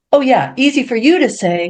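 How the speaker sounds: high, mock-indignant come-back; wife retorting to her husband; sarcastic retort.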